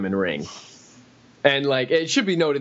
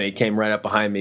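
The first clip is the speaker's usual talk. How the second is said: ordinary talk, nothing marked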